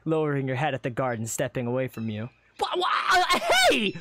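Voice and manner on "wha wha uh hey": high, loud stammered protest; sputter, then yelp in protest; indignant sputter. Acted. Adventurer protesting as friend steps away, indignant.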